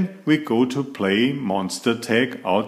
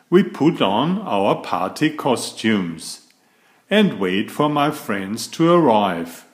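ordinary delivery

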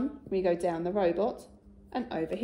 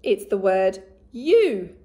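you said loud and slow; announcing the new word; 8 dB louder, slower than usual. Shown as a demonstration. Teacher reveals the new tricky word, loud and slow. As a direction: announce you loudly and slowly to the class. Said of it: "My turn".

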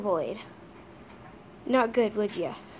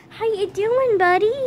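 very high, louder greeting; 9 semitones higher, 5 dB louder than usual. Real, not acted. Owner greeting her dog Jared, very high coo. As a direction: sing it sweetly to the little dog.

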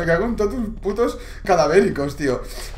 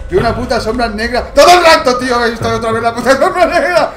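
loud, higher panicked yelling with a nervous laugh; panicked shouting; 4 semitones higher, 9 dB louder than usual. Real, not acted. Streamer scared by a shadow, shouting in panic.

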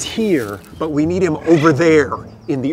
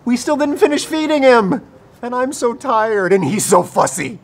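whining complaint; 8 semitones higher, 5 dB louder than usual. Acted. Tired ranger complains about feeding fussy baby, whining complaint. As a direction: whine it out, worn out.

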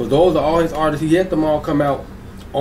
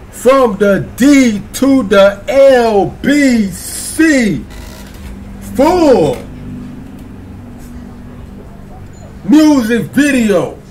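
hyped announcer shout; 6 semitones higher, much slower than usual. Pretend. Host announcing [name] and [name] video, shouting slowly. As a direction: boom each word out like a hype man.

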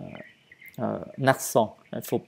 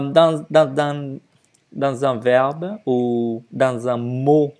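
ordinary talk

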